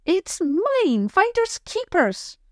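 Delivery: put-on high, slow childish taunt; stubborn little girl. Girl refusing to give back the teddy, high stubborn taunt. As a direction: dig in your heels, sing-song.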